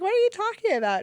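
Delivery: high, loud, disbelieving question; shocked into asking; alarmed. Retold or imitated. Founder hearing from the accountant about the bank, alarmed.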